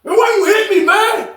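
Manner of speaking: high shouted protest; shocked cry of why you hit me; retold yell. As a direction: yell it out, stunned and hurt. Victim yelling after being hit.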